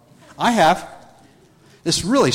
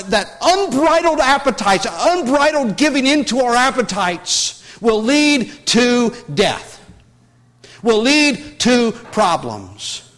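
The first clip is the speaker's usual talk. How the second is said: raised, emphatic preacher's voice; fervent preaching; 6 semitones higher, 4 dB louder than usual. Acted. Preacher warns the congregation about unbridled appetites, fervent and raised. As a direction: drive the warning home to the congregation.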